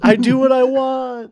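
high, loud cartoon refusal; mock defiant yell. Playing the villain yelling back a refusal, high mock yell. Joke of yelled reply, played out.

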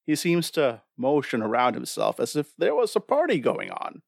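ordinary talk, nothing marked

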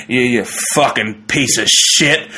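put-on the drunk headliner insulting the officer, slow and slurred. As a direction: slur it like a drunk yelling from the car. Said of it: "you just hear random".

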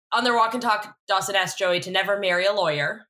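normal talking voice

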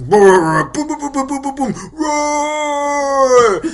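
high rattling booms, then a roar; mouth-made booms and roaring; imitated movie sound effects. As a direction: vocalize explosions and a big roar. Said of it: "especially if the sound effects".

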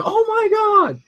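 mock shocked gasp. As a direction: recoil in horror. Imitating crowd's shocked reaction.

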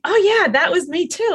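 high, eager "oh yeah, me too"; light up as you spot yourself in it; a mother hearing her own story in a podcast; excited recognition. Retold or imitated. Quoting her own reaction.

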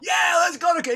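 high-pitched rowdy party yell; rowdy excitement. Retold or imitated. Bandmates hyped to go jam at night, rowdy excitement. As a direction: whoop it up like drunk bandmates.

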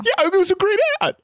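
crying. Acted. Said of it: "You're crying".

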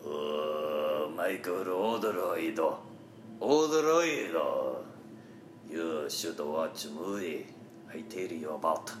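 put-on low, slow samurai with a Japanese accent. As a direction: drag out the name like a stern old samurai. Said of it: "a samurai would say".

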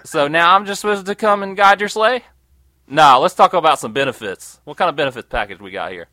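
loud, indignant negotiating; pretend defiant Rudolph. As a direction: Rudolph telling Santa off. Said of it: "I'd be like".

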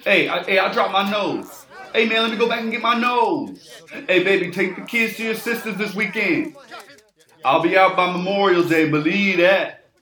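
loud, defiant shouting; yelling while arrested. Acted. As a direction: holler back while being dragged. Arrested man yelling while hauled off.

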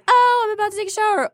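other partner. Acted.

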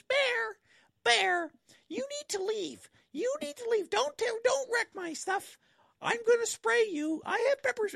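imitating a woman scolding a bear in a very high, loud squeaky voice; high-pitched voice. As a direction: squeak the warning way up high like a scared lady. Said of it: "she's got this high-pitched voice".

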